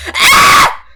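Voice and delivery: sharp high startled scream; sudden shriek. Real, not acted. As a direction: let out a piercing yelp. Sudden shout while unboxing.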